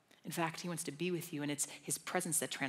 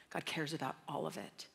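usual speaking voice

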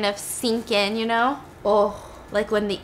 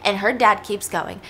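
ordinary delivery

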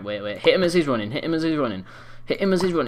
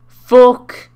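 loud, drawn-out curse; explosive high-pitched shouting; angry shout; 4 semitones higher, 13 dB louder, much slower than usual. Real, not acted. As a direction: yell it at your opponent. Yelling at opponent in game.

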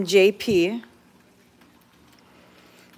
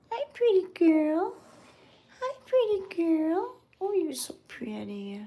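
high pet voice; 7 semitones higher than usual. Real, not acted. Woman greeting a cat in a high baby voice. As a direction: coo up high at the kitten. Cooing at a kitten.

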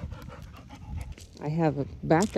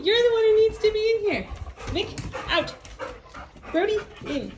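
high scolding; 13 semitones higher than usual. Real, not acted. Shooing the cat out of the kitchen, scolding. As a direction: shoo the cat out sharply.

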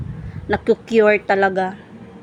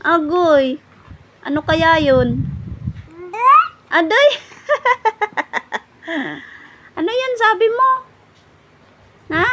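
much higher cooing voice; baby talk; 8 semitones higher than usual. Acted. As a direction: coo and giggle at the baby. (napakataas at malambing na boses sa sanggol)

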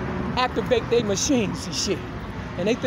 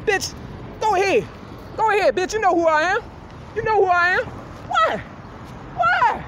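retold shouting; 9 semitones higher than usual. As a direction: dare him to shoot, shrieking. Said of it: "like he was gon".

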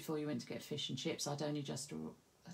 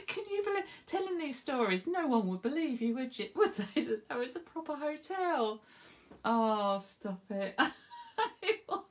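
laughing; 7 semitones higher, 3 dB louder than usual. Real, not acted. Incredulous laughing aside.

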